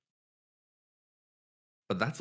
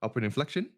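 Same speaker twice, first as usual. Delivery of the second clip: "inflection" rising at the end; upward inflection; 6 semitones higher, faster than usual. Shown as a demonstration. Coach demonstrating the rising tone on "Upward inflection". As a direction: lift the last syllable of "inflection" like a question. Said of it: "like that".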